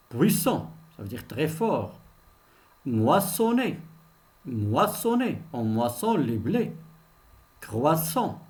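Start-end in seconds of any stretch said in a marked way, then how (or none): none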